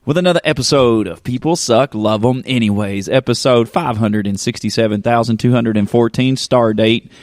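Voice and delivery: high, stretched-out showman announcer voice; host opening his show with a big announcer voice; hype podcast host intro. Acted. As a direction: welcome the crowd like a big-stage MC.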